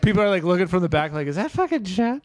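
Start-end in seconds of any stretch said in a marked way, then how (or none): 1.3-2.2 startled onlooker. Retold or imitated. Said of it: "I didn't know his voice got that".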